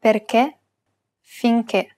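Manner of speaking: "Perché, finché" with a stressed closed final E. Shown as a demonstration. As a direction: narrow the last E of "Perché, finché" toward "ay", no glide. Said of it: "pronounce it as a closed E".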